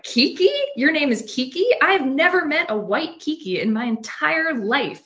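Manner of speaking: pitched high in disbelief, repeating the name back; incredulous. Retold or imitated.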